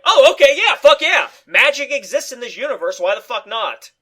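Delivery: thrilled laughing fuck yeah; excited. Retold or imitated. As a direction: whoop like a delighted fan. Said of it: "I was like".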